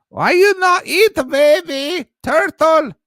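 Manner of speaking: very high, loud falsetto coaxing; mock coaxing voice. Streamer mimicking a pushy host offering turtle, high squeal. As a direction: squeal it like coaxing a picky baby.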